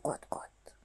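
tiny breathy cluck, almost no voice; the hen answers the truck with a cluck. A narrator's reading. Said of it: "مرغ گفت".

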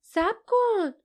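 high-pitched desperate call to stop; urgent shout. A narrator's reading. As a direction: cry out to halt them, pitch leaping up. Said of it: "لیف فریاد زد".

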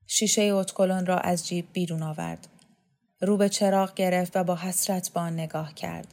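usual speaking voice

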